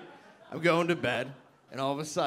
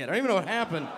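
normal talking voice

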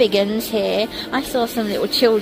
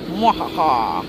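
low evil mwahaha cackle; a mock villain's gloating laugh; mad scientist laugh; 6 semitones lower than usual. Evil laugh after halloumi.

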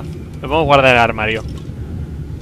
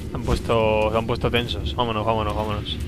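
hushed retreat; 5 semitones lower, 11 dB quieter than usual. Real, not acted. Sneaking away from tense guards.

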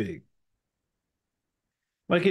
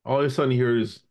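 ordinary talk, nothing marked

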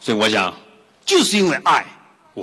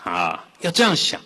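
ordinary talk

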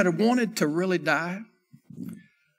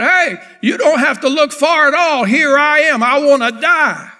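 louder, higher mock offer to die; 6 semitones higher, 7 dB louder than usual. Preacher voicing Elijah to Jezebel, louder and higher. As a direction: hand himself over loudly, half-mocking. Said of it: "he could have just said".

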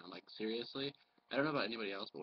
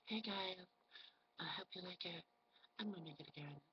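high, soft, sugary-fake vlogger voice; mock a fake-sweet woman greeting her followers, high and quiet; put-on girly voice, softly pitched way up; 8 semitones higher, 7 dB quieter than usual. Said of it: "it sounds like they're so fake".

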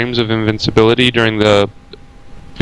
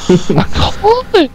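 much higher, slow, smug "called"; gloating sing-song. Pretend.

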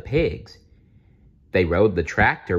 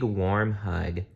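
usual speaking voice

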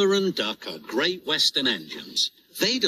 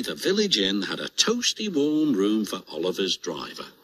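ordinary delivery

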